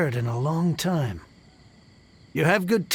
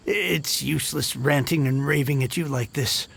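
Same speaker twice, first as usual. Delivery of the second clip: normal talking voice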